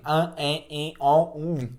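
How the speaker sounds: an, en, in, on, um said through a pinched nose; nasal vowel demo. Shown as a demonstration. Teacher showing students nasal sounds with hand on nose. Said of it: "a mão no nariz e falasse assim".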